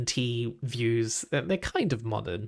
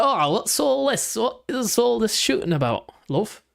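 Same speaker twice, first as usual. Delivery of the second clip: put-on northern accent; 9 semitones higher than usual. Imitating northern granddad asking about the video game. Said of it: "so I'll do an accent for him".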